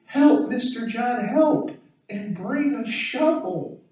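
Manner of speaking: high, sweet little plea for help; sweet daughter. Retold or imitated. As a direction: imitate a neighbor's daughter pleading sweetly. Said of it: "Sweet as she can be".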